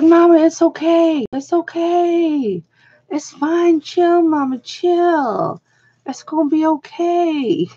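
put-on high, drawn-out young girl voice; little girl soothing. Girl reassuring the panicking traveler, high and drawling. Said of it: "a little girl laugh so hard".

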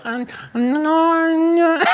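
very high, loud mock refusal; hammed-up high-pitched housekeeper voice; mimicked housekeeper. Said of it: "And she go".

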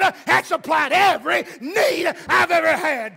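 loud, rising preacher's cry; preaching at full force. Acted. Preacher telling the congregation a missionary story, full force preaching. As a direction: preach it to the rafters, building.

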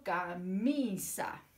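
Camisa drawn out very slowly; teacher modelling Camisa for the children, slow and spaced; slow syllables. Shown as a demonstration. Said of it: "modelling the word".

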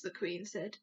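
plain reading voice

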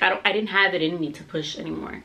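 ordinary talk, nothing marked

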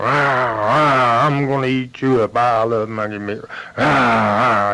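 angry roaring bear voice; mad bear. Acted. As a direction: roar and threaten like a furious bear. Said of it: "That bear so mad he's going".

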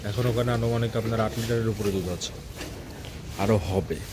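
low, hushed talk near an animal; speaking softly. Real, not acted. Farmer talking beside a cow being milked, speaking softly. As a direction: keep it down so as not to disturb. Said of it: "আমি একটু আস্তে আস্তে কথা বলছি".

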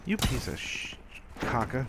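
high, frustrated outburst; exasperated. Real, not acted. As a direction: demand it in disbelief. Frustrated at enemy.

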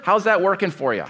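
ordinary talk

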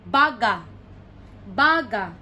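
"baga" as loud BA, falling ga; stress on first syllable. Shown as a demonstration. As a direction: lean on BA in "baga". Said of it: "the first one is".

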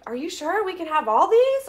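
lightly voiced thrilled coworker; amazed coworkers. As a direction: imitate delighted coworkers asking. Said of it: "They're like".

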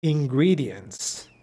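very slow Ingredients, falling tune; slow intonation model. Shown as a demonstration. Teacher models the intonation of Ingredients slowly. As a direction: stretch Ingredients and let it fall. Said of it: "La entonación de ingredientes es importante".

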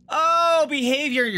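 high loud surprised oh; sudden surprised exclamation. Real, not acted. Sudden exclamation at game screen.

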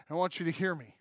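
ordinary talk, nothing marked

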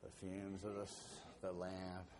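low, soft sleepy drone; mock the monotone speaker; droning monotone. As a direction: drone on like a dull preacher. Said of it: "I don't know if he was monotone".

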